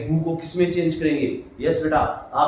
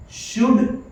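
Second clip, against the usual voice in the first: "should" lifted high and held; stress on should; 7 semitones higher than usual. Shown as a demonstration. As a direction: hit the word "should". Teacher stressing the modal. ("should" पर ज़ोर देते हुए)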